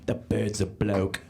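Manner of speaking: slow, low mock British bloke; British accent impression. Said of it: "my impression of a transphobic British person".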